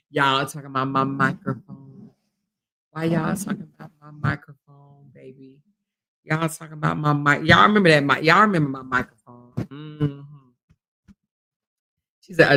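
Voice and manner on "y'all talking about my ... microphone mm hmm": much lower, softer voice; playful low voice. Pretend. Streamer answering viewer about her old microphone, low playful voice. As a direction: drop it right down, playfully.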